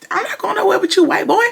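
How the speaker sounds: high mocking defiance; Gary refusing Hudson, high mock defiance; mock defiant refusal. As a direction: pitch it way up, defiant and sassy.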